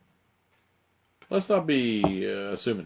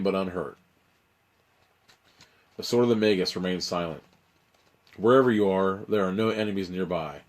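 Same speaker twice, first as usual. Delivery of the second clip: ordinary talk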